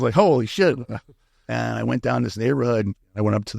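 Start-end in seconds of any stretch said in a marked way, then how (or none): 0.1-0.8 pitched up, thrilled exclamation; excited holy shit. Retold or imitated. Retelling his excitement at finding a dealer, excited and pitched up. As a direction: blurt it out like you just got your first lead. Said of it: "I was excited".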